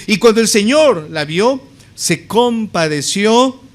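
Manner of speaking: "Señor" proclaimed high and loud; stress on Señor. Shown as a demonstration. Preacher reading the verse to stress Jesus' authority, emphatic on "Señor". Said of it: "Lucas quiere enfatizar la autoridad".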